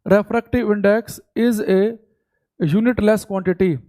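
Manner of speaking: each word spaced out for note-taking; steady classroom dictation pace; slow dictation. Shown as a demonstration. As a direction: dictate it slowly so they can write. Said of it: "तो लिखेंगे".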